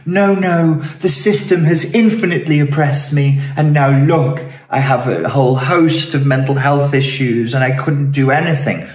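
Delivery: high-pitched, hammed-up self-pity; mock victim whining. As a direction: protest like a put-upon victim, mockingly. Playing a self-pitying victim mockingly.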